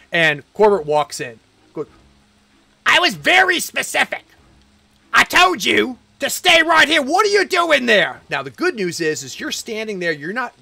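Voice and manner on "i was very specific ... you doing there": angry. Acted. GM voices angry Corbett.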